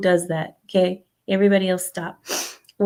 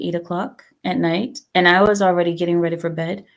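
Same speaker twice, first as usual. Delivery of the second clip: usual speaking voice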